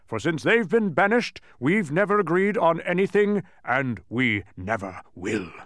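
loud, high declaration, slowing at the end; thundering. A narrator's reading. Mathematician on his feud with Azaz, thundering. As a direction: proclaim a stubborn vow at full voice. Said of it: "he thundered again".

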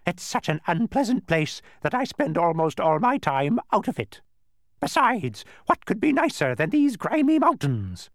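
high, loud bird squawk; disgusted bird. A narrator's reading. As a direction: complain in a screechy bird voice. Narrator voices the disgusted bird.

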